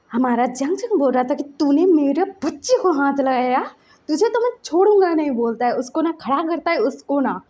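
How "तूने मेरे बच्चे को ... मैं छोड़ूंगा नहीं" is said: retold threat rising higher and louder, faster at the end; building to a mock furious yell; angry threat. Father threatening the woman who hurt his child, rising fury. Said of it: "उसको जो गुस्सा चढ़ा ना".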